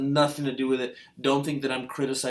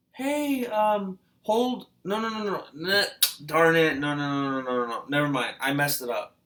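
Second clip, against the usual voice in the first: imitating a mom. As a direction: mimic the mom fumbling over her words, pitched up. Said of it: "and she'll tell one of the daughters".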